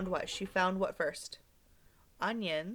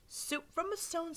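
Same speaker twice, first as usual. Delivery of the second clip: put-on high, softer old lady; a touch of old woman wonder; 8 semitones higher, 5 dB quieter than usual.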